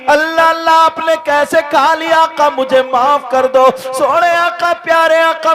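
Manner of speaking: loud, driving sermon at full force; fiery preaching. Acted. Preacher tells the crowd of the Prophet's kindness, fiery full-force preaching. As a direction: preach it out with fervour.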